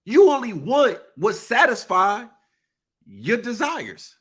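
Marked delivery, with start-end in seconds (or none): none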